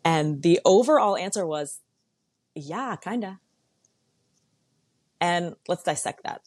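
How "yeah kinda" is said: quiet, sheepish "yeah, kinda"; sheepish admission. Pretend. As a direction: admit it softly, a bit embarrassed. Admitting she is the problem.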